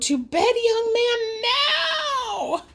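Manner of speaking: high, strained yell of an angry mom; angry bellow. A narrator's reading. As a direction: send him to bed with a furious cry. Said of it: "glared and bellowed".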